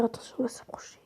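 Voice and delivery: hushed whisper while sneaking up. Real, not acted. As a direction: whisper so nobody hears. Sneaking close to read sign.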